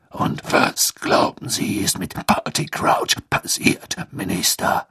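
rasping, breathy, slow growl; deep rasping growl. Acted. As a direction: growl it like a grizzled auror. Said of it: "ertönte Moodys knurrende Stimme".

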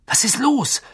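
urgent "what's wrong?" tone; alarmed question. A narrator's reading. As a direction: ask it in sudden alarm. Harry asks, startled by her fear.

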